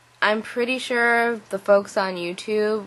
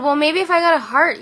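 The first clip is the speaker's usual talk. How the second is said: higher, louder cheeky retort; sassy; 9 semitones higher, 5 dB louder, faster than usual. Retold or imitated. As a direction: throw his tattoo back at him. Sassy comeback to dad.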